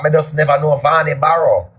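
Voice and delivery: normal talking voice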